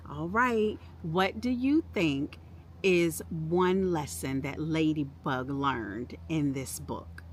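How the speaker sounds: usual speaking voice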